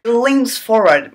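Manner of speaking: normal talking voice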